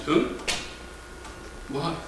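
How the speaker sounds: ordinary talk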